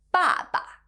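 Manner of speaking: "爸爸" starting high and dropping steeply; "爸爸" high fall, then a light short syllable; fourth falling tone. Shown as a demonstration. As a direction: let the first syllable of "爸爸" fall hard. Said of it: "has the fourth falling tone".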